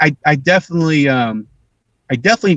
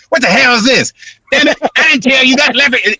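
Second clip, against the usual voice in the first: loud, higher mock outburst; imitating angry dad; 10 semitones higher, 4 dB louder than usual. Dad coming home to the family, loud mock-angry scolding. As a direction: blow up like dad walking in. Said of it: "And my dad come home".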